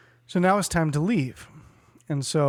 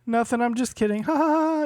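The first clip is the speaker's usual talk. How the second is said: higher, giggly brush-off with a fake laugh; mock tipsy laugh; 8 semitones higher than usual. Mimicking the drunk friend laughing off a weird remark. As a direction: play it off as a joke, cackling. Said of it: "And she'll be like".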